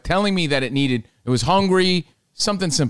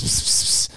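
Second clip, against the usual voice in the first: whispered pss calling; 4 dB quieter than usual. Retold or imitated. Calling a cat.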